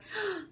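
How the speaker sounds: sharp high gasp; relieved gasp. A narrator's reading. Horton finds his friends, sharp gasp. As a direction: gasp on finding them.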